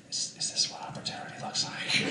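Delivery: hushed, halting whisper; awed whisper. Retold or imitated. Suspect eyeing the open interrogation-room door, awed whisper. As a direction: whisper it as if discovering an escape.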